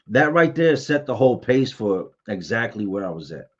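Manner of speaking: ordinary talk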